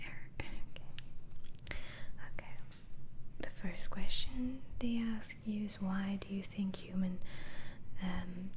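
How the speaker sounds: usual speaking voice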